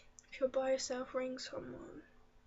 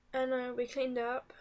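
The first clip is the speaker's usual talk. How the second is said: usual speaking voice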